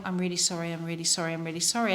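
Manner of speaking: low, soft, repeated mumbled apology; sheepish apology. Retold or imitated. Embarrassed seatmate apologizing to his seat, low and sheepish. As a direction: imitate someone ashamed, dropped in pitch and volume.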